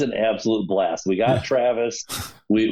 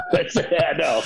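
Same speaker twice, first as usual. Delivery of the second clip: laughing; 6 semitones higher than usual. Real, not acted. Laughs at a stranger's joke.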